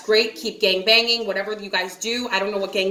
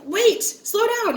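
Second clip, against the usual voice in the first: retold alarm; 11 semitones higher, slower than usual. Retold resisting forced drink.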